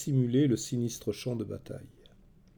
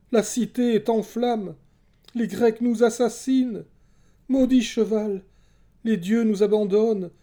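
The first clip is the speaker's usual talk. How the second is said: loud, high-pitched frantic prophetic cries; hallucinating trance; 10 semitones higher, 7 dB louder than usual. A narrator's reading. Cassandra in a trance seeing Troy burn, hallucinating. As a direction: wail out each horror in terrified bursts. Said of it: "Ses propos devenaient de plus en plus hallucinés".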